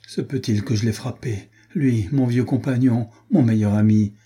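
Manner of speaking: usual speaking voice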